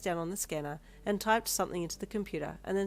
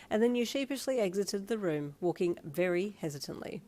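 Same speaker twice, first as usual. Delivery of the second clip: ordinary delivery, nothing marked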